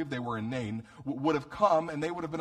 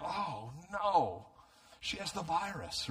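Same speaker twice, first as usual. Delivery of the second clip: mock dismay; 3 semitones higher, 4 dB quieter, slower than usual. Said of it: "wouldn't have been happy noticing".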